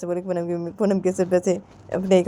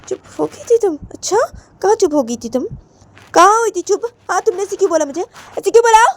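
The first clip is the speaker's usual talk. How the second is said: high-pitched interrogation, louder at the end; challenging mother; 13 semitones higher, 5 dB louder than usual. Acted. Mother demanding why daughter answered back, angry. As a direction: question her sharply, building to loud.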